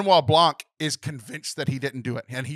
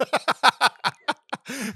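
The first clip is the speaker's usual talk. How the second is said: high burst of real laughter; laughing; 11 semitones higher, 3 dB louder than usual. Real, not acted. Teased by a co-host over a word, high laughter. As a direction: break into a long, high laugh. Laughing at co-host's teasing correction.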